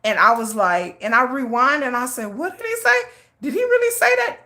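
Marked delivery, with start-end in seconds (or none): 2.4-4.4 very high-pitched disbelieving questions; incredulous reaction. Retold or imitated. Viewer rewinding after being caught off guard, high and incredulous. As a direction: squeak it out in retold disbelief.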